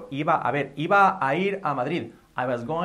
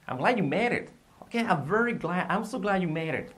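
glad; 6 semitones higher than usual. Shown as a demonstration. Acts glad example with rising enthusiasm.

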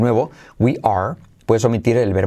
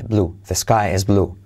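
ordinary talk

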